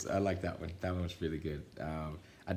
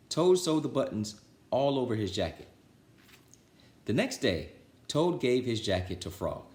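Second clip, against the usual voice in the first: put-on lively, louder read-aloud voice for children; storybook reading; 4 semitones higher, 5 dB louder than usual. A narrator's reading. Reader telling Frog and Toad to children, lively storybook voice. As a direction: read it like bedtime story time for kids.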